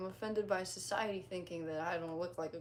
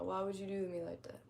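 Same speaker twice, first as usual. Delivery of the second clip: normal talking voice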